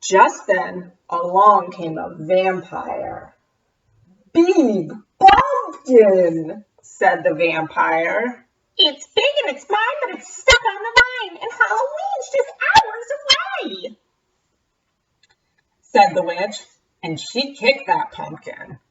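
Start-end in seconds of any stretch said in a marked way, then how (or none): 4.3-6.6 slow vampire drawl. Acted. 8.8-14.0 high, quick cackly witch talk. Acted.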